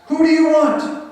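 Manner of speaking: high, loud call across a crowd. Acted. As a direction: project it over a mob. Pilate calling out to crowd.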